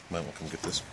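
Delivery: ordinary talk, nothing marked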